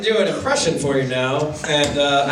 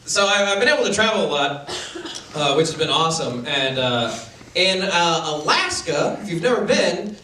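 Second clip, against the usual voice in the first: usual speaking voice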